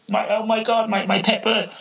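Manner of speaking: high, stunned gasp of grief over the bird; shocked cry. Acted. Man shocked his bird died.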